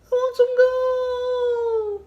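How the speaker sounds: very high, slow, little whine; a last shrunken squeak of a plea; tiny fading whine. Acted. The shrinking whinosaurus's last words, a tiny whine. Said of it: "it shrunk and shrunk".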